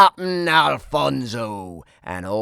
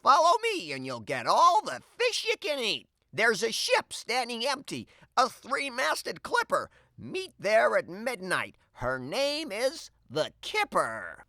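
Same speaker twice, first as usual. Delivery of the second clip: high, quick urging; rally the gang, a touch conspiratorial; cat captain rallying his crew; lightly voiced cat; 12 semitones higher than usual. A narrator's reading. Alfonso rallies the cats.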